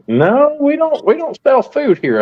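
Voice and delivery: high, light mock clerk voice; clerk's reply. Retelling the clerk's phone reply, high mock voice. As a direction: squeak out the clerk's polite answer. Clerk answers in retold call.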